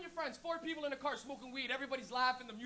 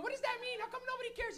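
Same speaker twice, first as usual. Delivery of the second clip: put-on indignant old lady, voice pitched way up; complaining old lady; 10 semitones higher than usual. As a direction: demand answers in a high mock old-lady voice. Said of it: "the lady literally went".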